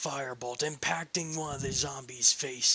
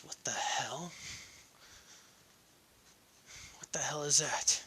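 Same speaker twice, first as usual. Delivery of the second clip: surprised whisper; 6 dB quieter than usual. A narrator's reading. Surprised by new spell.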